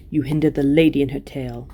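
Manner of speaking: ordinary delivery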